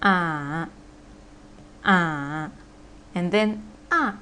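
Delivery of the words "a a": drawn-out "a" dipping low; third tone. Shown as a demonstration. Teacher modelling for repetition, low dipping "a". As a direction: sink "a" low, then let it lift. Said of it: "four tones".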